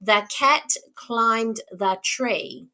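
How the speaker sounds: slow, each word of the sentence separate. Shown as a demonstration. Teacher models counting words for a child, slow and separated. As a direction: space the words out so they can be counted. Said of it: "We want them to hear how many words".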